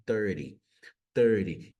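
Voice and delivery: American THIRTY, like "thur-dee"; flapped thirty. Shown as a demonstration. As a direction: tap the T in THIRTY. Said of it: "ganha aí esse som de R".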